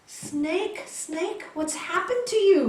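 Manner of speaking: storyteller's swami in alarmed dismay; acted shocked concern. Swami finding the snake almost dying, high shocked concern.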